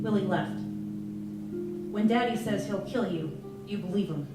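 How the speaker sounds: usual speaking voice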